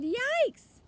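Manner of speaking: high, loud startled yell; yelled yikes. A narrator's reading. Father sees the cart full of ice cream, yelling. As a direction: shriek it in alarm.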